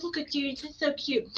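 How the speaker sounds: put-on soft, high baby talk; small, high, adoring voice; Selah cooing. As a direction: imitate a child cooing under her breath. Repeats daughter's cooing at spider.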